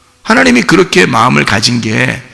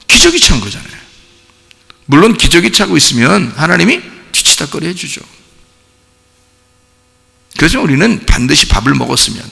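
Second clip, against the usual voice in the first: ordinary talk, nothing marked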